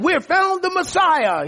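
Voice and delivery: quoted high-pitched excited proclamation; excited announcement. Retold or imitated. Philip telling Nathanael of the Messiah, excited announcement. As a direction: relay the big news, pitched well up.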